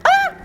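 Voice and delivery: high loud shriek of fright; jump-scare scream; startled scream. Real, not acted.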